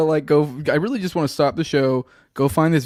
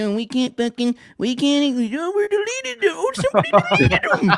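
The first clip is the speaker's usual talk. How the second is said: rising higher and higher into a squeaky, hammed-up voice; mock panicked excuse; 12 semitones higher than usual. Mocking an official's excuse about deleted files, building into a squeaky falsetto. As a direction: climb into a silly falsetto as the excuse falls apart. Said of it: "he's like".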